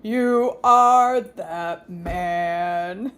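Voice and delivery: very slow, drawn-out accusing line; slow dramatic cartoon accusation; imitating Pa Grape. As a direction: stretch every word out like the cartoon grape. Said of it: "when he looks at King David, he says".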